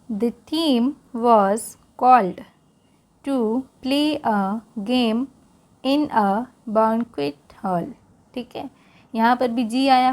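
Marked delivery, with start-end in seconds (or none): 0.1-7.9 slow, careful read-aloud for learners; English read-aloud for Hindi-speaking students, slow. Shown as a demonstration. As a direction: read it slowly so learners can follow along.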